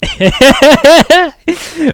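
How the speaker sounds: a big high burst of laughter; loud high laugh. Real, not acted. Host laughing hard at the guest's team jab.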